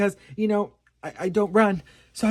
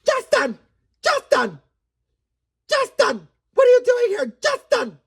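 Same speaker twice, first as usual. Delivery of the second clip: very high, scolding cry; Hailey Bieber; 14 semitones higher, 5 dB louder than usual. Retold or imitated. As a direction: call him out in a squeaky, raised pitch. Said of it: "Hailey Bieber came and said".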